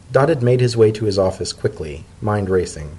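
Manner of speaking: plain reading voice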